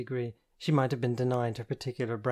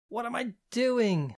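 slow, much higher exasperated question; self-accusing; 11 semitones higher, 3 dB louder, slower than usual. Acted. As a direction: scold yourself aloud. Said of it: "I accused myself, this time out loud".

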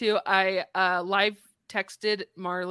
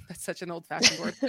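ordinary delivery

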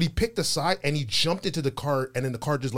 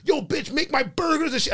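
high, loud imitation of a bossy customer; hammed-up demanding woman; 13 semitones higher, 4 dB louder than usual. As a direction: bark the order up high and brash.

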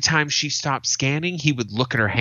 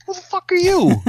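high, loud indignant snap; mock outraged comeback; angry retort; 6 dB louder than usual. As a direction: shout it back at a nosy stranger.

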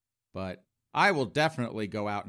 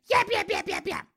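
squeaky high yap yap yap, louder; mock yapping; 3 dB louder than usual. Mocking straight chicks in the bar, high louder yapping. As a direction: imitate chattering drunk girls in a shrill squeal.

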